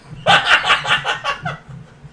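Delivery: high, loud, real laughing fit; burst of laughter. Real, not acted. Laughs at announcer's baffled remark.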